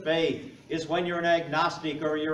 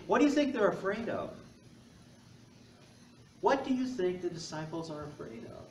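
ordinary talk

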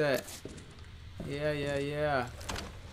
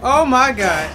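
panicked; 8 semitones higher, 13 dB louder, slower than usual. Real, not acted. Chased by zombie.

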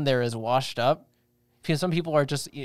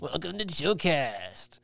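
slow, softer put-on host greeting; 5 dB quieter, slower than usual. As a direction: echo a mock celebrity shout-out, stretched out. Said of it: "From [name] saying".

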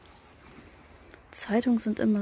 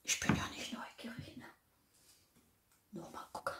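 sneaky hushed whisper; nervous night vlog whisper; 12 dB quieter than usual. Real, not acted. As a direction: whisper like snooping.

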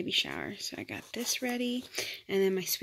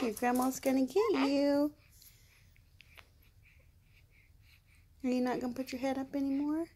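higher, sing-song cooing at a newborn; baby talk; 8 semitones higher, 4 dB louder than usual. Acted. Mom cooing at her newborn daughter, sing-song baby talk. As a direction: coo sweetly over the baby.

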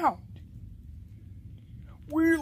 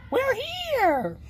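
high, excited call; 10 semitones higher than usual. Acted. Announcing arrival to the others, excited high call.

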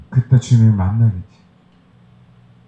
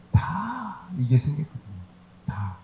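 sly hushed aside; 4 semitones higher, 10 dB quieter, slower than usual. Acted. Hints at a hidden gain.

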